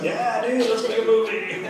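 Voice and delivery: jokey, upbeat buddy act; mock easygoing enthusiasm. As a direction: play the carefree pal, half laughing. Acts out easygoing director, laughing.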